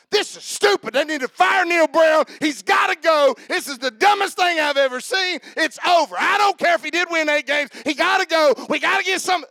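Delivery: high, loud ranting fan demanding the coach be fired; angry sports fan. Retold or imitated. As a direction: rant like a furious fan at the TV. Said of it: "you say".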